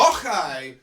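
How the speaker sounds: loud Scottish "och, aye"; Scottish exclamation. Shown as a demonstration. Quoting revellers at a ceilidh, Scottish exclamation. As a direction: call it out with a Scottish throaty "och". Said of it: "people screaming at a ceilidh".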